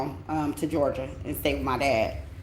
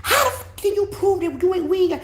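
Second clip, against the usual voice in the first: much higher, fast accusing mock mom; imitating angry mother; 13 semitones higher than usual. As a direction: ham up mom yelling about her locks. Mother quoted in argument.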